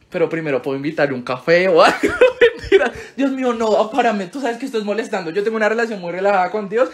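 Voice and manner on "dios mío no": ordinary talk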